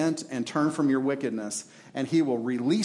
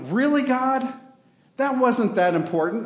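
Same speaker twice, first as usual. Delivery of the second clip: a high, louder whine of surprise at God; mock disbelief; 8 semitones higher, 3 dB louder than usual. Sinner objecting to God about an old sin, high mock protest. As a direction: play the sinner shocked at being caught. And you're like.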